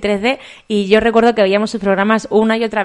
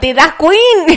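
Chiquito de la Calzada voice; 7 semitones higher, 6 dB louder, much slower than usual. Retold or imitated. Quoting Chiquito catchphrase.

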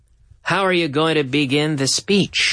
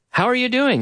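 normal talking voice

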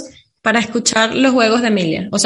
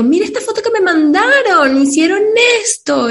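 higher bright prompting voice; upbeat mom; 7 semitones higher than usual. Retold or imitated. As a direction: coax the child with interest. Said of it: "le digo".

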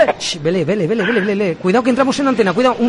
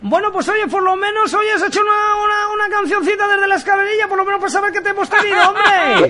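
bright, raised on-air host voice; radio announcer; 12 semitones higher than usual. Acted. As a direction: sell it like a live radio host.

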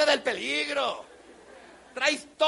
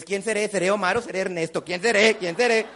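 mock-panicked youngster, building louder; identity crisis; 3 semitones lower, 3 dB louder than usual. Youngster with two names wonders who he is, mock panic. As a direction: fret faster and louder over who you are. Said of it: "tienen crisis de identidad".

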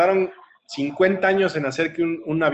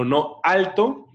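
higher, drawn-out command to stop; firm interruption; 6 semitones higher, much slower than usual. Retold or imitated. Coach stopping the girl's fears, firm command. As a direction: halt someone's worrying firmly and slowly.

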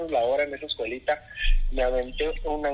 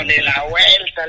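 loud, higher, drawn-out dismissal; retold scoffing refusal; 5 semitones higher, 12 dB louder, slower than usual. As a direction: blurt it out slowly and loudly. Retold scoffing refusal of low pay.

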